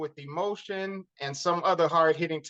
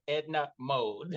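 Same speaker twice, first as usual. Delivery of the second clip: slow announcing; 3 semitones lower, 5 dB quieter, slower than usual. Retold or imitated. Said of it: "The way she said it".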